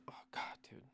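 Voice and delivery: exasperated whisper; whispered exasperation. Real, not acted. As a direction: breathe it out in despair. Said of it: "exasperated whisper about cat".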